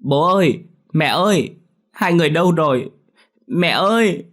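high, loud cry from a nightmare; a little girl screaming for her parents; terrified child calling out for mum and dad; scared child crying out. Acted. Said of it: "đột nhiên hét toáng lên".

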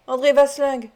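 a dark, heavy warning. A narrator's reading. Louis confronts Vasling over his dying father, grim. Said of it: "d'une voix sombre".

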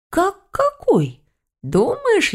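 very high, stretched-out echo question; incredulous retort. Acted. Grandmother answers her puzzled granddaughter, incredulous. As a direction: throw it back high, as if obvious.

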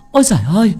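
high, loud wail of a horrified wife; hollering for the village. A narrator's reading. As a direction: raise the alarm in a high, loud cry. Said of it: "la làng la xóm". (la làng la xóm thất thanh)

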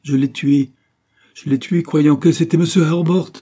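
loud, high outburst; distraught confession. Acted. Sick woman confessing the killing to William, distraught.

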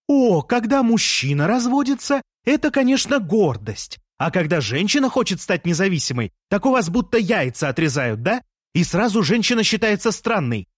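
mock him from the window; sarcastic retort. A narrator's reading. Leya mocking Jean from the window.

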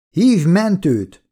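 high, slow shouted order. A narrator's reading. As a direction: call out urgently for help, drawn out. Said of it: "ordítom Jane-nek". (elnyújtott, magas hangú kiáltás)

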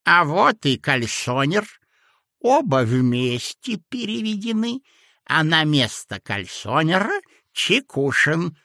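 put-on sweet-talking sinister old man; sly old man. A narrator's reading. Old clerk character voice. (вкрадчивый зловещий старичок)

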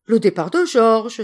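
loud startled exclamation; exclaiming. A narrator's reading. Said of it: "s'écria madame de Saint-Aimar".

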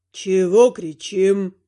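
low, slow ghostly voice; drawn-out low ghost voice; deep ghost voice. Acted. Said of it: "спрашивает голос". (низкий медленный голос призрака)

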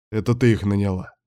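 usual speaking voice

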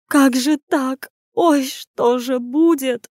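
slow, high, drawn-out dismay; fretful wail; lamenting. Acted. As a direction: moan it out in distress. Lady laments over the prince.

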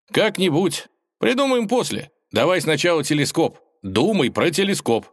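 put-on high, insistent young hero; high-voiced eager prodding; impatient urging. Ivan hurries Wolf to the telescope.

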